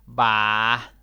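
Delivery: "va" called out loud; exclaimed va. Shown as a demonstration. Teacher shows "va" as a loud call. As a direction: blurt "va" as an exclamation.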